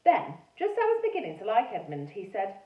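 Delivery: ordinary delivery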